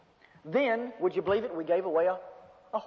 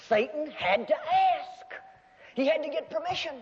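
preaching shout; 7 semitones higher than usual. Real, not acted. Preacher to congregation, a slow ringing shout. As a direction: drive the point home with a shout. Preacher stressing Satan needed permission.

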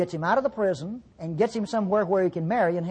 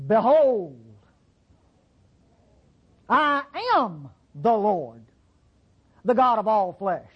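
proclaiming; slower than usual. Acted. God's words proclaimed.

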